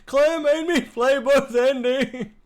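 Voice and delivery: mock whining. Streamer complains to chat about the game's endings, hammed-up whine. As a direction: play up the self-pity for laughs. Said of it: "It's enough whining".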